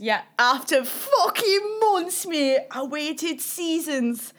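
slow, high, loud mock wail; dramatic exasperation. Real, not acted. Telling her friend about ending a long dry spell, slow mock wail. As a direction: drag it out like a tragic confession.

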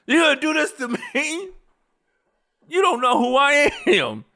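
high, incredulous and menacing in jest; mock outraged Denzel. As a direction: play the affronted big man with a laugh. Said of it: "going full Training Day Denzel".

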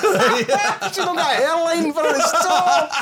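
high, slow, laughing. Real, not acted. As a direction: laugh while you say it. Laughing through the line.

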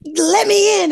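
put-on over-the-top New York Jersey accent; high, loud Jersey-accented plea. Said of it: "the bad New York, Jersey accent".

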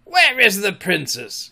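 sudden commanding bark; abrupt demand. A narrator's reading. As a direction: demand it like a captain. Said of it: "he demanded abruptly".

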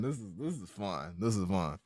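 a hint of the low rasp; low rasp, fading out; keep the smoker rasp. Host still in the joke voice, low rasp. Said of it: "talking like this".

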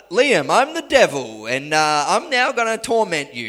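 loud mock villain announcement; the devil announcing himself to Liam, hammed up. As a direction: play a cartoon devil introducing himself. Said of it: "the devil doesn't come out the front and go".

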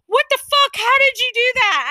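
high, loud, flabbergasted demand; imitating freaked-out disbelief. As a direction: cry out in shocked disbelief, quoting him. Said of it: "he's just freaking out and shaking".

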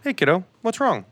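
mock-paternal, lifted pitch; dad checking in. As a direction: play a concerned dad addressing a little kid. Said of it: "have you considered maybe just going".

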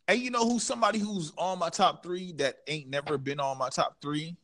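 normal talking voice

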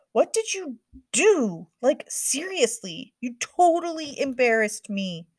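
high, loud, slow accusation; angry sister. A narrator's reading. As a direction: snap at her sister. Sisters arguing.

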